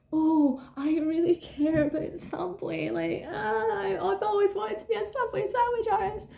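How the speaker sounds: high, mock-sincere job applicant voice; fake eager applicant. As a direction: ham up the gushing candidate. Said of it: "Not like".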